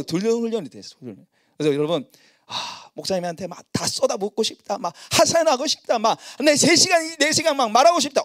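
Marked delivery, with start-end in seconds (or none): 4.8-8.2 eager. Retold or imitated. Congregation's urge voiced.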